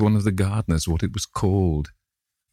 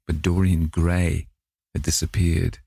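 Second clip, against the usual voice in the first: usual speaking voice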